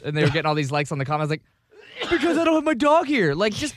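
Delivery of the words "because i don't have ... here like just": high, loud replayed comeback; exasperated retort. Answering a commenter who wants his dog, exasperated. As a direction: argue back in mock exasperation. Is that [name] in an argument.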